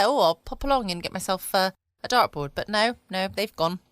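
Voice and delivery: ordinary talk, nothing marked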